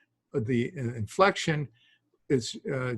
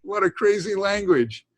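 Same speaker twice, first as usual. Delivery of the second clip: exasperated; 7 semitones higher, 5 dB louder than usual. Retold or imitated. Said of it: "this is point where you go".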